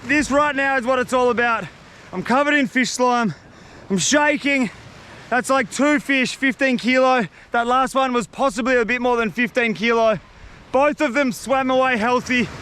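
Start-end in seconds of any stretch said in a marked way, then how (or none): none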